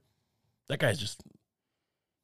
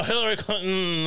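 higher-pitched, light celebrity impression; a hint of an actor impression; Robert De Niro; 4 semitones higher than usual. Comic naming the candidate as the actor, light De Niro impression. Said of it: "that's the Robert De Niro impression".

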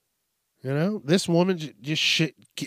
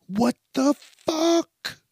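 drawn-out disbelief; 10 semitones higher, much slower than usual. Real, not acted. Mind blown by the joke.

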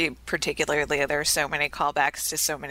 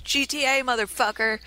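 higher-pitched movie-line holler, only mildly raised; half-voiced mock yell, pitched up; mock scream; 8 semitones higher than usual. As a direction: quote a screamed catchphrase without full volume. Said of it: "and screams".

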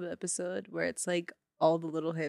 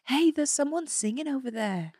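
higher-pitched amateur Aussie; Australian accent; 5 semitones higher than usual. Pretend. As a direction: play an Australian tourist, pitched up. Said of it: "we're Australian tourists".

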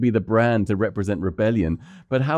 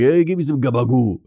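lightly imitated Jersey mobster; a hint of a Sopranos mob boss; Tony. Mob boss asking for deli meat, lightly imitated. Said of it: "I always hear Tony saying that in The Sopranos".

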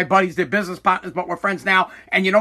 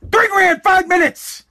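exasperated outburst; 9 semitones higher, 3 dB louder, slower than usual. Real, not acted. Exasperated about Peloton cost.

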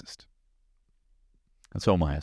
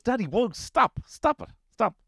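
put-on high squeaky child crying stop; a little boy protesting his dad, very high; kid's voice; slower than usual. Son telling dad to stop peeking, in a squeaky high kid voice. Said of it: "He goes".

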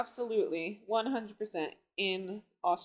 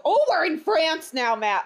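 mock excitement; 5 semitones higher, 7 dB louder than usual. Playful exclamation to audience.

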